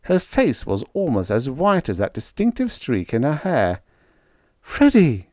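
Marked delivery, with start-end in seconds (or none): 4.6-5.3 high, loud squeal of his name. A narrator's reading. Girlfriend Panda rushing in to Freddie, squealing. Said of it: "she squealed".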